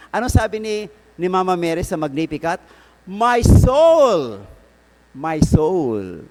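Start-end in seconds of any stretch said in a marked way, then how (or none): 3.0-4.4 high, ringing cry of praise; loud proclamation. Acted. Priest proclaiming Mary's Magnificat to the congregation, loud and high.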